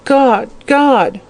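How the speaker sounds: high, pitiful moaned appeal; acted frightened whimper. A narrator's reading.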